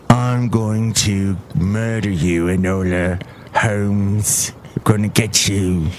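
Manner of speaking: slow, low British villain; British accent. Acted. British villain threatening the film heroine, low and slow. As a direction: menace in a deep put-on British accent. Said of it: "doing my British accent like this".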